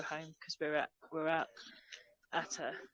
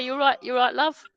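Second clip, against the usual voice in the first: loud, higher London "you're right, love"; 6 semitones higher, 11 dB louder than usual. Shown as a demonstration. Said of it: "Or we say".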